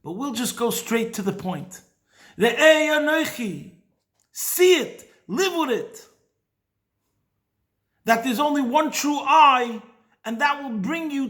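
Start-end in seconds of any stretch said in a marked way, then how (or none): 2.4-6.1 raised, slow and ringing; emphatic preaching. Real, not acted. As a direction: proclaim it to the room. Preacher urging listeners to see.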